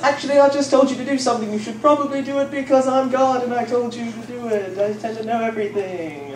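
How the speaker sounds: a lightly hammed-up God losing patience. God scolds a reluctant Moses in a mock divine voice. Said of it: "and God says".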